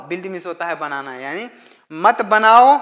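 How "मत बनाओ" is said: emphatic command. Shown as a demonstration. Teacher stresses the prohibition.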